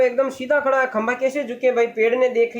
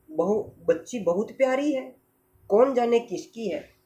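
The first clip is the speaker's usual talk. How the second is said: put-on soft voice over a sleeping child; tender, hushed; 5 dB quieter than usual. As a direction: admire the child gently and quietly. Tree bends over the sleeping child. (सोती बच्ची पर कोमल, धीमी आवाज़)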